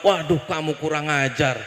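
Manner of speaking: normal talking voice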